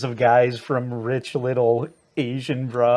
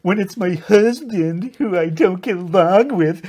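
ordinary delivery

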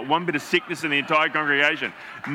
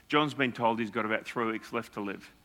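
voice breaking; 5 semitones lower, 6 dB quieter than usual. Retold or imitated. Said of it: "And with voice breaking".

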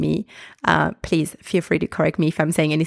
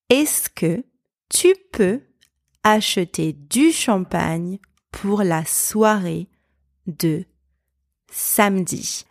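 phrase-by-phrase demo. Shown as a demonstration. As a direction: break it into small chunks for learners to repeat. Same example repeated phrase by phrase.

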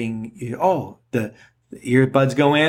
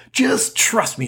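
higher, insistent trust me; emphatic trust me; 8 semitones higher, slower than usual. Pretend. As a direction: press the point eagerly. Repeated reassurance, second higher.

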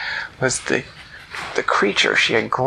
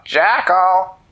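higher, louder call; a called-out name; mock yell; 8 semitones higher, 7 dB louder than usual. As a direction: shout the name from the porch.

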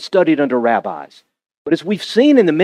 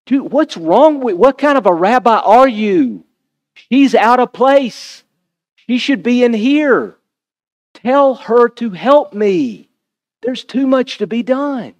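exasperated Martha; 5 semitones higher than usual. Acted. Martha's complaint paraphrased.

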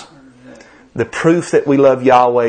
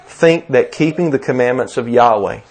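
ordinary delivery

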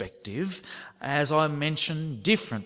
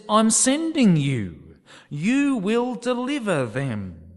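higher, louder, slow and weighty; commanding call; 7 semitones higher, 6 dB louder, slower than usual. Acted. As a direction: proclaim the commission with authority. God's commissioning words.

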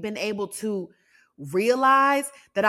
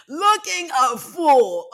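slow, high, louder teasing repeat; high-pitched self-mockery; 6 semitones higher, 5 dB louder, much slower than usual. Real, not acted. Podcaster repeating the self-roast, high and drawn out. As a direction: stretch it out high and loud, laughing at oneself.

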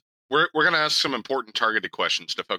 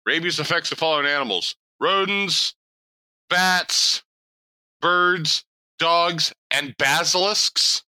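higher read-aloud list, long pauses; reading out loud; 5 semitones higher, slower than usual. Retold or imitated. Imitating a friend reading a rabies list, deliberate suspenseful read-aloud. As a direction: space out each item, stretching the last. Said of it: "he's reading this out loud".

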